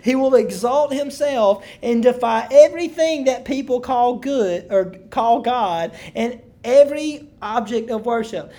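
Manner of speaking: loud, driving Bible reading; preacher reading scripture with force; emphatic scripture reading. Acted. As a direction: proclaim the passage to a congregation. Rereads the scripture passage aloud.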